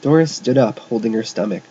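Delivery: ordinary delivery, nothing marked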